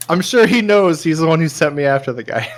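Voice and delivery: shouting back at the contact over his shoulder; shouted quip. Acted. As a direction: fling it back over your shoulder loudly. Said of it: "I'll be like".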